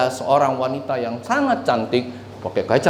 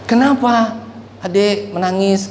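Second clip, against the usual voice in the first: wife questioning; 7 semitones higher than usual. Retold or imitated.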